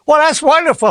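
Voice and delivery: high, bright-pitched fake delight; mock cheery. To a grieving man, a mock cheerful high gush. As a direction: gush as if terrible news were great. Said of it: "imagine if I lit up and said".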